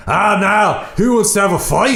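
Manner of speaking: hammed-up brawler calling everyone out; mock tough-guy challenge. Host acting out Drew daring the room, hammed-up swagger. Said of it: "He's just like".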